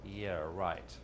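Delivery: low, soft, scoffing; sarcastic inner voice. Shown as a demonstration. Subconscious mocking the income goal, low sarcastic scoff. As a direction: drop the pitch and sneer. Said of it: "then your subconscious is saying".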